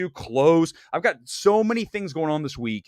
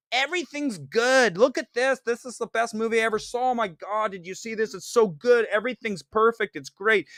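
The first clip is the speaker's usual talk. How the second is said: higher, over-eager hype voice; mock cheerful promo host; gushing Fandango guy; 4 semitones higher than usual. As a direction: gush about every movie like a shill. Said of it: "like the guy at Fandango".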